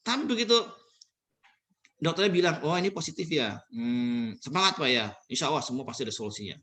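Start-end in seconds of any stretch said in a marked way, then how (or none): 2.7-6.6 lower, softer reassuring doctor; calm the patient down; gentle doctor. Retold or imitated. Speaker voicing a doctor reassuring a covid patient, gentle and low.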